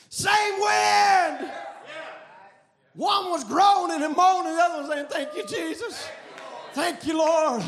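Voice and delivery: loud, driving revival sermon delivery; impassioned preacher, rising to shouts; full-force preaching. Real, not acted. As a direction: preach it hard, stretching the big phrases.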